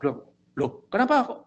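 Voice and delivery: high, loud startled cry. Retold or imitated. Caller startled when the line goes dead, high shout. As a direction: jump up in alarm, pitch leaping. Scammer's startled reply, acted.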